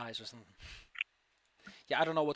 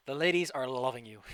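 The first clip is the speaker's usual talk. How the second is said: ordinary talk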